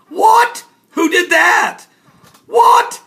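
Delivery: very high loud yelps; outraged shock. Acted. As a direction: burst out in alarm. Shocked outburst over Beirut.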